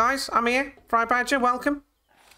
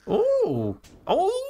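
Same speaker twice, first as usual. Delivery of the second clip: surprised ooh. Real, not acted. Startled by something.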